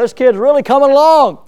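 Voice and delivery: mock-proud parent, bursting with joy; proud parent delight. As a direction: gush like a dad hearing his child's first word. Proud parent hearing first word.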